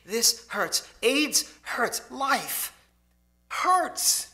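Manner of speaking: each hurts rising to a high, loud cry; anguished cry. Retold or imitated. Dying woman crying out to her visitor, anguished and loud.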